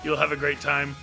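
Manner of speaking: usual speaking voice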